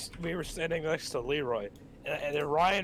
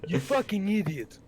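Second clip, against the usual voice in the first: put-on voice; 4 semitones higher, 4 dB louder than usual. Insult in put-on voice.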